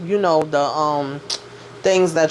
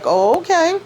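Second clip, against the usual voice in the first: high, sing-song put-on voice; mock unbothered; 12 semitones higher, 3 dB louder than usual. Danny politely dismissing Preston, high and sing-song. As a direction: voice her polite indifference up high.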